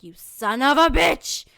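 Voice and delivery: loud, slow, drawn-out curse; cursing the professor who canceled class; angry curse. Retold or imitated. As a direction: spit it out slowly in fury. Class canceled without notice.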